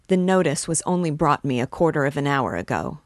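usual speaking voice

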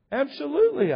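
Absolutely thrown out high and loud; Absolutely, loud and high, falling at the end; emphatic absolutely. Real, not acted. Repeated emphatic admission.

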